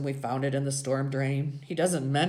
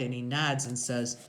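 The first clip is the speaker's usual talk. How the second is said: usual speaking voice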